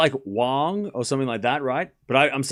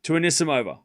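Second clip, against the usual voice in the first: live tennis commentary, rising high on big points; play-by-play call; 4 semitones higher than usual. Acted. Streamer calling the tiebreak for chat, live play-by-play. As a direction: narrate every shot as it lands.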